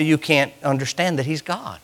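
usual speaking voice

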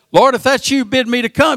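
high, pleading call to the Lord; Peter calling out. Retold or imitated. Peter in the storm calls to the Lord, high and pleading. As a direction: reach up to him across the water.

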